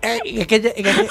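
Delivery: giggling through the words; can't stop laughing while talking. Real, not acted. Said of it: "me pega la risa".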